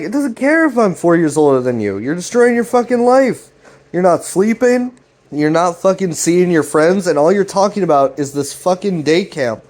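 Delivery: high-pitched acted frustration; heated scolding. Jeff scolding Greg about destroying his life, acted anger.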